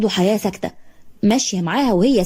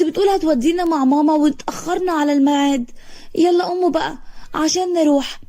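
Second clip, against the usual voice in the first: much higher, desperate wailing voice; wailing plea; 6 semitones higher than usual. Acted. Girl wailing at her dead parents to get up. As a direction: beg them to wake up, on the edge of hysteria. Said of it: "حياة بصويت".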